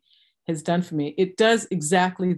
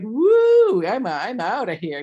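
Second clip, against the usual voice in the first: a high whoop, then off; mock cheer of freedom; whooping excitement; 5 semitones higher, 5 dB louder, slower than usual. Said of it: "I was like".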